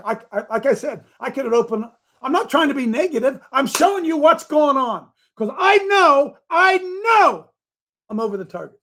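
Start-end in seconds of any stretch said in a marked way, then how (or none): 5.4-7.5 crying out. Real, not acted. Preacher's emotional peak.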